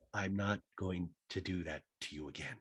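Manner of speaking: quiet, subdued imitation; Crystal impression voice. Voicing Crystal, very soft.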